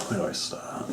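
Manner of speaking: soft voiceless murmur, falling away; whisper. Real, not acted. Vlogger murmuring before his wrestling recap. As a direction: whisper it, lost for a start. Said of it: "unvoiced fall".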